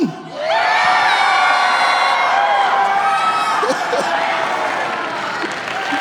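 high shrieking laugh of delight; a high whooping laugh that breaks into a shout. Real, not acted. Student lifts the weight, crowd erupts.

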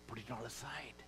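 whispered, low and gentle; hushed command. Acted. Pastor voicing Paul to the congregation: a soft whisper. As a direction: lean in and breathe it.

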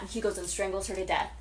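ordinary talk, nothing marked

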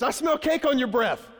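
put-on a boy triumphantly busting his sister; mock accusing child. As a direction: son turning the tables on his sister, high and loud. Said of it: "Caden looks at her and he goes".

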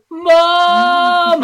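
high, loud cry for mom; shout. Retold or imitated. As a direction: yell as he falls. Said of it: "he's just like".

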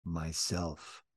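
very soft, low "Myself"; barely audible, hushed; soft whisper. A narrator's reading. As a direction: whisper it so it can hardly be heard. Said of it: "whispered so softly you could hardly hear it".

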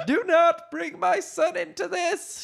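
very high-pitched outraged shout; high, strained yell of a protective mother; furious shout. A narrator's reading. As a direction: warn him off at the top of your range. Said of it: "shouted Leia".

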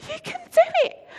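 high, loud cheering from the sidelines; shouting encouragement. Retold or imitated. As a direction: yell it like a parent at a race. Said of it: "I started shouting".

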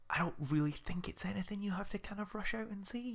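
softly whispered aside. Real, not acted. Telling his co-host the film can wait, whispered. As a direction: let it slip out as a secret. Confiding it's not worth seeing.

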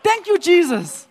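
high, loud thankful cry; jubilant praise. Retold or imitated. Jumping up in the meeting, shouting praise. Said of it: "I jumped up".

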